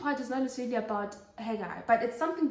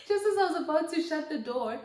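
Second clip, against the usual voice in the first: laughing; 3 semitones higher than usual. Real, not acted. Repeats line with a laugh.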